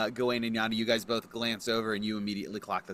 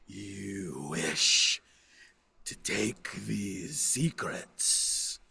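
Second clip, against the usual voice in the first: very slow, whispery spectral voice; drawn-out, haunting pronouncement; solemn ghost; 3 semitones lower, much slower than usual. Acted. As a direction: breathe it out like an apparition.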